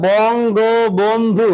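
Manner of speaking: বঙ্গবন্ধু ধীরে ভেঙে ভেঙে বলা; slow demo. Shown as a demonstration. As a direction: slowly spell out Bangabandhu by syllable. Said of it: "উচ্চারণ করে". (ধীরে উচ্চারণ করে দেখানো)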